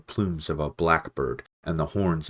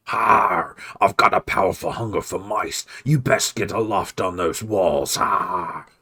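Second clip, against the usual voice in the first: loud growling menace; snarling rat; 2 semitones higher, 5 dB louder than usual. Acted. Clooney frightening the mice, loud snarl. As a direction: snarl and scare them off.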